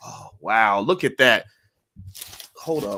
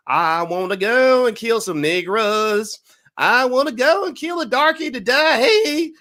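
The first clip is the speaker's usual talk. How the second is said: mocking country singer; 10 semitones higher, 7 dB louder, slower than usual. Mocking a country singer, high and loud. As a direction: belt it out like a mock hillbilly singer. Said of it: "he was like".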